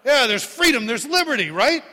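loud, high affirmation; preacher echoing the crowd; exultant shout. Real, not acted. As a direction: celebrate the answer.

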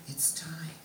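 soft, voiceless whisper; hushed whisper. Retold or imitated. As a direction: whisper it as a solemn message passed on. Message repeated in a hush.

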